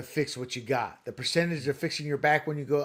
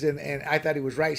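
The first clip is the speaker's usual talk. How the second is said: ordinary talk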